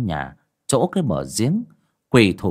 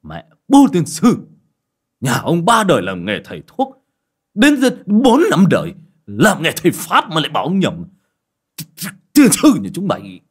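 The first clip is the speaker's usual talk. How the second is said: loud, higher angry cursing as he leaves; grumbling curse; 8 semitones higher, 7 dB louder than usual. A narrator's reading. Healer cursing the family while storming off, loud angry grumble. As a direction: spit out the curses on the way out. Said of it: "ông còn lẩm bẩm".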